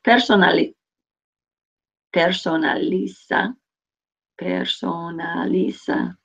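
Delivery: "Personaliza" said slowly and carefully; slow careful pronunciation. Shown as a demonstration. Teacher modelling "Personaliza" slowly for students. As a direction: drag out "Personaliza" syllable by syllable. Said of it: "Trying to add some extra there".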